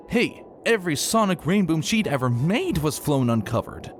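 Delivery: plain reading voice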